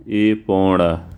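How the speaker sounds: एपणा stretched out very slowly; slow spelling out. Shown as a demonstration. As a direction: draw out एपणा syllable by syllable. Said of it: "एपणा होता है वो". (एपणा को बहुत धीरे खींचकर)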